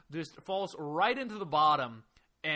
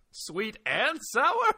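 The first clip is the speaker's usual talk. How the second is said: incredulous; 9 semitones higher, 5 dB louder, much slower than usual. Real, not acted. Incredulous echo.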